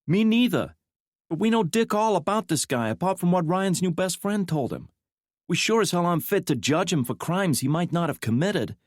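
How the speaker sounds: put-on a fiery young woman speaking out; young woman's voice. A narrator's reading.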